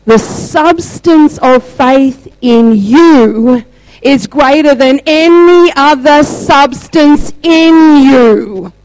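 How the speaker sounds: loud, very slow, drawn-out declaration; forceful preacher's pronouncement, each word stretched; booming proclamation. Retold or imitated. Retells prophetic word from God.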